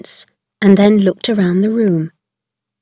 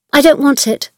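high, flat-out pushy refusal; refusing; 6 semitones higher than usual. A narrator's reading. Mary refusing breakfast, sulky. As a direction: push the plate away. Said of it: "she said".

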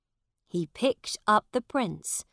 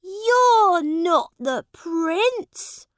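mocking schoolboys; 12 semitones higher, 12 dB louder, slower than usual. Schoolboys mock the beggar prince.

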